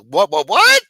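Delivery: loud, high-pitched mock shock; shocked disbelief. Retold startled reaction to news.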